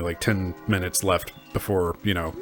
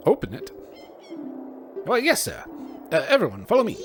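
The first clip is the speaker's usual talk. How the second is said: high, nervous skeleton guard; squeaky, dutiful skeleton soldier; 13 semitones higher, faster than usual. Acted. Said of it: "I don't know what this skeleton voice is".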